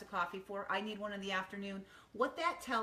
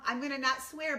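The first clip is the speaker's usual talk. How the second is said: ordinary talk, nothing marked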